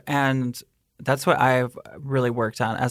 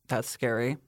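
normal talking voice

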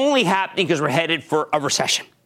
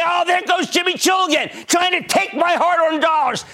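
scoffing viewer; 9 semitones higher than usual. Retold or imitated. Host mimics a doubting viewer mocking him, hammed-up scoff. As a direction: jeer at Jimmy like a doubting viewer. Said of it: "you would say".

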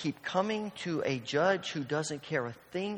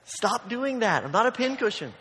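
raised, slowed scolding; scolding mom; 7 semitones higher, 5 dB louder than usual. Retold or imitated. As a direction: snap at the child to quit it.

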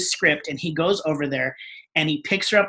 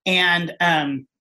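ordinary talk

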